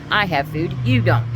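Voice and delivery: loud, high retort, imitated; mother's taunting argument. Mother boasts to daughter over food, taunting. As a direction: mimic her rubbing it in, punching the last words. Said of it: "that's how she talked".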